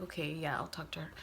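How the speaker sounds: hushed whisper to herself, deciding; whispered self-talk. Retold or imitated. Whispering to herself before talking to Letty. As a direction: breathe it out like a private thought. Inner thought, hushed decision.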